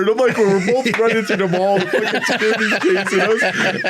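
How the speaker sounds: high, breathless laughing narration; giggling through the chase. Real, not acted. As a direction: laugh as you tell it. Laughing retelling of mall chase.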